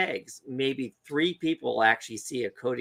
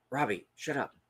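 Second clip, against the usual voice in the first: hushing; 8 dB quieter than usual. Retold or imitated. Dad stalking a grouse hushes his son, hushing. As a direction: shush the kid under your breath.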